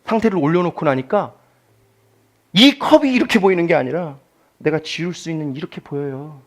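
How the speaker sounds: normal talking voice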